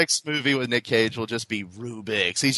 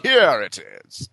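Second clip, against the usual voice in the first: posh monocle gentleman; 6 semitones higher, 6 dB louder, much slower than usual. Retold or imitated. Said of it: "he makes that noise".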